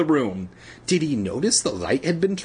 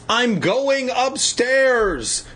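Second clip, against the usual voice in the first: calling out; 10 semitones higher, much slower than usual. A narrator's reading. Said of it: "Conan called to his parents".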